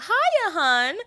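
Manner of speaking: high, loud chirpy housewife greeting; Agatha's housewife greeting. Retold or imitated. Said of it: "And I love".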